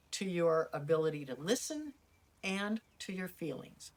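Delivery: ordinary talk, nothing marked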